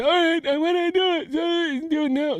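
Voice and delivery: very high, eager squeak; patient squeaking his eager yes; squeaky high-voiced man. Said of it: "the man said".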